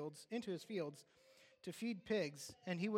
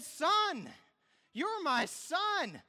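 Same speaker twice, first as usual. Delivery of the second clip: father crying out, much higher, much louder, very slow; father insisting to his son, slow and loud; joyful father; 11 semitones higher, 9 dB louder, much slower than usual. Acted. Father claims returning son.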